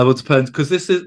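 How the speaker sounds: ordinary talk, nothing marked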